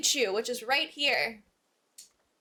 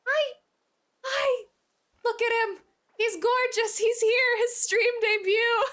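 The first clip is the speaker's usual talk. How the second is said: high squealing delight; excited; 10 semitones higher than usual. Real, not acted. Cat on stream, excited.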